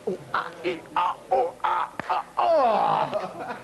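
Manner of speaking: string of short grunts building to a cry; straining squeeze noises. Acted. Burger server squeezing a zit before customer, straining noises.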